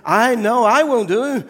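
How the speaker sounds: very high, loud eager cry; eager brass vessel. Acted. Brass vessel pushes itself at the master, shrill eager cry. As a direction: wave yourself forward eagerly. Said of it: "cried the vessel".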